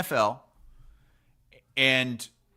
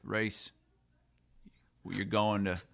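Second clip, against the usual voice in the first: bad Australian accent; 2 semitones lower, 6 dB quieter than usual. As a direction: imitate an Australian punter, softly and badly. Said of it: "I'm not too good with Australian accents".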